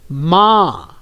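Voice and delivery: माँ with a long nasalised aa; nasal vowel demo. Shown as a demonstration. Teacher modelling माँ for children to repeat, nasal vowel. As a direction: stretch माँ and let the vowel ring through the nose. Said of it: "देखिए इसका उच्चारण किस तरह से होता है".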